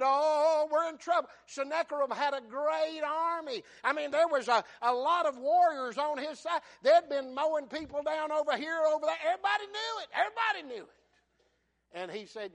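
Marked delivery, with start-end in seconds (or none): 0.0-11.0 loud, raised-pitch sermon storytelling; fired-up preaching. Acted. Preacher tells of a feared great army, fired-up preaching. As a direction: preach it hard, climbing higher to the end.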